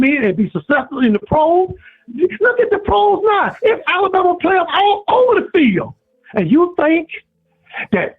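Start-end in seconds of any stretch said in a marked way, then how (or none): 2.1-5.9 passionate shout. Real, not acted. Caller shouting praise of Saban's players.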